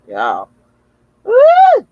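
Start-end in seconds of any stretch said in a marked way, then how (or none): 1.2-1.9 high, loud joyful whoop; a real burst of delight, very high and loud; excited whoop. Real, not acted. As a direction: let out a big thrilled woo. Excited after hearing the song.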